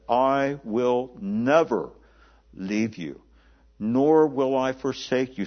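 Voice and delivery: slow, with "never" stretched and weighted; preacher lingering on "never"; emphatic promise. Shown as a demonstration. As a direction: give each phrase its own heavy, slow beat. Quoting God's promise in sermon.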